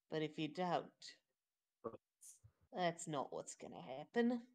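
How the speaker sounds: a hushed, menacing warning to a cowering goblin; quiet menace. Acted. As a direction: lean in and threaten calmly. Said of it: "That's menacing as hell".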